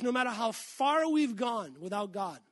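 usual speaking voice

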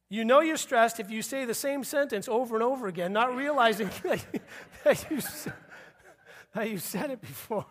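words breaking up with real laughter, pitch up; can't hold the line for giggling; laughing through it. Real, not acted. Preacher to congregation, repeating his joke, real laughter breaking through.

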